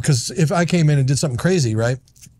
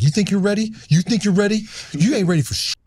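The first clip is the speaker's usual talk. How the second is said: mock tough-guy taunt; 6 semitones higher than usual. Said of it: "And I'm like".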